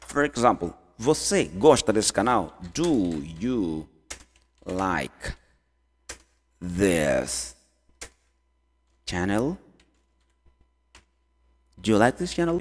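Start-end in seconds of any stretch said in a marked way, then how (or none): 2.7-9.6 very slow, low, long gaps between words. Shown as a demonstration. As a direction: dictate the question one word at a time. Teacher builds question word by word. (palavra por palavra, lento e grave)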